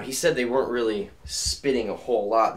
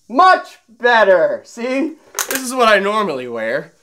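excited; 10 semitones higher, 9 dB louder than usual. Real, not acted. Relieved, showing off goggles.

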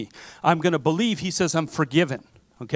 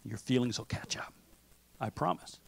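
whisper; 4 semitones lower, 10 dB quieter than usual. Real, not acted. Pastor softly promises the congregation.

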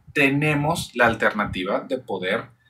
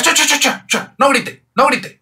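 much higher and louder, imitating a caregiver hushing a noisy child; mock shushing caregiver; 13 semitones higher, 5 dB louder than usual. Caregiver silencing a happy shouting child, high mock shush. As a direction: shush the shouting kid with a high, fussy parent voice. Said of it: "un niño que se pone súper contento y que empieza a gritar".

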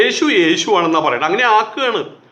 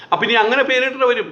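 ordinary talk

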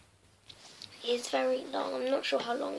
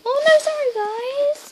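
surprised; 13 semitones higher, 9 dB louder than usual. Real, not acted.

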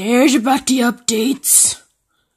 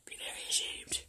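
barely audible reproach; very soft, hushed shaming; whispered scolding; 24 dB quieter than usual. Real, not acted. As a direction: whisper it at the dog to shame it.

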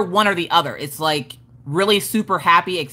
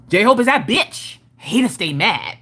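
hype shout-out. Shown as a demonstration. Showing how the viewer's name must be said, sassy hype. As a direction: proclaim the name with attitude. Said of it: "you can't just be like".

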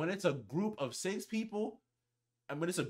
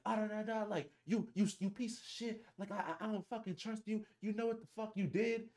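mock yelling girl; 8 semitones higher, 4 dB quieter than usual. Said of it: "she's yelling".

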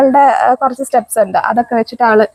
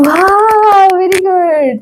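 delighted praise; 5 semitones higher, much slower than usual. Real, not acted. Mother praising her toddler for finding things, delighted. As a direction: lift the praise up high.

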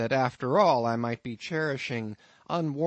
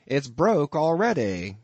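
a lightly voiced innkeeper, higher and a bit louder; amused landlord; 5 semitones higher, 6 dB louder than usual. Innkeeper teasing his lodger about the harpooneer, lightly voiced. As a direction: spin the tale with a sly chuckle underneath.

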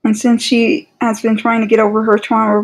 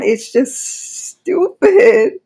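higher, slow drawn-out stupid; exasperated; 8 semitones higher, much slower than usual. Real, not acted. Fan ranting at the writers, slow exasperated drawl. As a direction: drag it out in disbelief.

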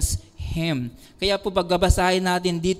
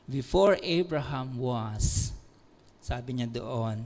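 much lower, much softer reverent hush; hushed solemn; 9 semitones lower, 8 dB quieter than usual. Acted. Preacher quoting Jesus to the crowd in a solemn hush.

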